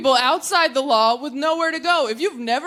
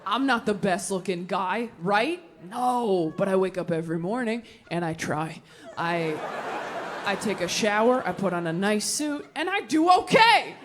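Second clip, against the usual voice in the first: resigned dad; 6 semitones lower than usual. Retold or imitated. Voices dad's frank reply to daughter.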